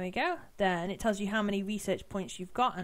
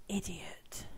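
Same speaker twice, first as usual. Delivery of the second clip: soft unvoiced whisper; 3 semitones lower, 8 dB quieter than usual. Real, not acted. Player whispering an insult at herself. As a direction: breathe the insult at yourself.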